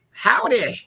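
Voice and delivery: high, loud Texas howdy. Shown as a demonstration. Teasing his friend about his Texas accent, a quick Texas howdy. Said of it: "have your accent".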